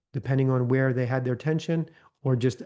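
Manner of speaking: ordinary delivery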